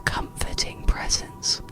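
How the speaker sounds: usual speaking voice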